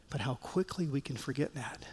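dropped to a soft, hushed voice; suddenly hushed, reflective warning to the congregation. Real, not acted. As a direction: let it fall quiet and inward.

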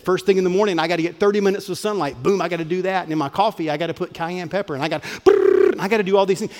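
mock a frantic self-improver ticking boxes; rushed hustle checklist voice. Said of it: "The Huberman podcast said".

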